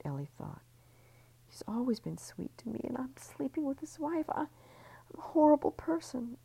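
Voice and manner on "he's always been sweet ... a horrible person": high, breathless whispered thoughts; rising panic, all in a whisper; whispered inner voice turning desperate; guilty whisper. A narrator's reading.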